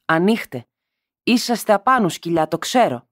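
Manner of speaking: bang out the words like you want the door to break; shouting with all her strength. A narrator's reading. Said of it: "φώναζε μ' όλη της τη δύναμη".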